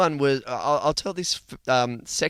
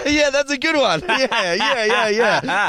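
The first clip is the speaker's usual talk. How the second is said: high laughing yeahs, much louder; 10 semitones higher, 6 dB louder than usual. Real, not acted. Host cracking up at co-host's joke, laughing loud.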